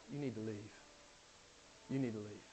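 hushed, low and solemn; grave warning. Real, not acted. Preacher warning churchgoers about false teaching, grave and hushed. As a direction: drop right down to a quiet, weighty warning.

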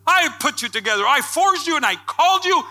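loud, high preacher's cry; fiery preaching. Acted. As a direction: preach it out at full force. Preaching at full force.

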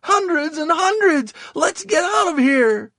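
loud, very high wail; panicked cry. A narrator's reading. Wounded Victor overwhelmed by the enemy's numbers, crying out. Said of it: "he cried".

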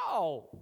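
short imitated "ow" of a poked animal; mock yelp of pain. Acting out the ox kicking the goad, mock pained yelp. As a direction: cry out as if jabbed.

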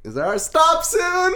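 high, drawn-out pleading question; mock uneasy plea. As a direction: imitate an awkward passenger wanting out, high and slow. Retelling plea from the car's back.

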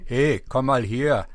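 calm, slow German dub line; a mild, unexcited German dub; flat German dub voice. Retold or imitated. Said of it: "And in English, it was like".

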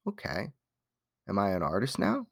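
thinking to oneself. Retold or imitated. Martial artist sitting there thinking, quietly musing to himself. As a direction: drop to a hushed private question. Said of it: "I'm sitting there thinking".

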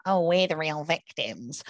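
mock whine, drawn out slowly; slow, put-on whiny complaint; Helen Joyce. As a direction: imitate a self-pitying victim, mockingly. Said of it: "does the whole fascist whiny thing".

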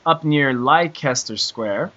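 Leicester said as "lie-KESS-ter", slowly; mispronounced Leicester. Real, not acted. Host reading UK friend's email, Leicester mispronounced as "lie-kester". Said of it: "Am I pronouncing that right, Mike?".

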